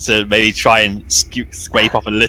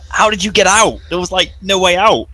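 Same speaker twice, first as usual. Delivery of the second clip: higher, baffled question; lightly voiced puzzled survivor; bewildered character; 9 semitones higher than usual. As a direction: ask it in honest confusion. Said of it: "it's like".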